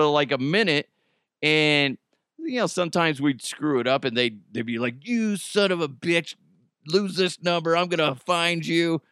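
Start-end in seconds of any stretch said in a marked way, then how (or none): 5.1-9.0 higher-pitched, mildly irate victim; mock angry threat. Prank victim threatens the caller, mock angry. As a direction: imitate a fed-up prank victim.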